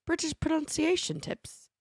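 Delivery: high British title read; put-on British accent.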